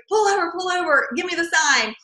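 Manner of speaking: high-pitched, urgent little request; excited kid calling out. Retold or imitated. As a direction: imitate a kid urging a driver to stop. Excited son retold, calling out.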